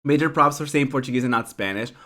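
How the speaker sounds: usual speaking voice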